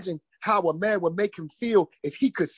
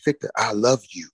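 low, tender voice of God; divine voice; 9 semitones lower than usual. Retold or imitated. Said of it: "It was one voice".

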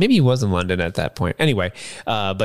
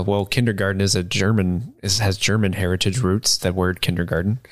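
ordinary delivery

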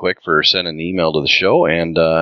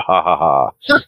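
mock laugh. Mock laugh reading email.

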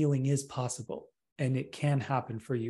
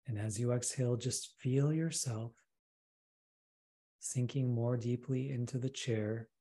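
soft, intimate meditation voice, much softer; right in your ear; 5 dB quieter than usual. Acted. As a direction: close and hushed, easing them down.

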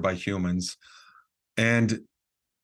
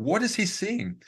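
much higher, baffled question; puzzled surprise; 10 semitones higher than usual. Retold or imitated. Narrator puzzling in the dark about the cameraman, baffled. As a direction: wonder aloud what he sees, bewildered. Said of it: "I'm going".

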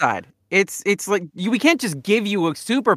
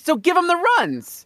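high punchline; 10 semitones higher than usual. Real, not acted.

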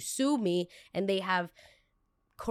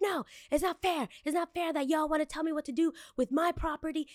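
put-on much higher, childish indignant whine; whiny protest; 9 semitones higher than usual. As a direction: complain like a sulky kid. Retold protest to staff.

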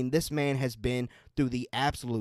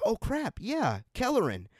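excited recognition; 10 semitones higher than usual. Retold or imitated. Recalls seeing a familiar face.

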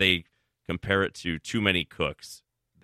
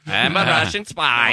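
put-on loud, slow Russian spy accent; 4 semitones higher, 7 dB louder than usual. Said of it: "with full spotlight".

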